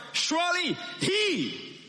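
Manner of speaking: he stretched out, high and slow; stress on he. Shown as a demonstration. Preacher teaching the Hebrew emphasis on he. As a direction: land hard and long on he. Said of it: "should be emphasized".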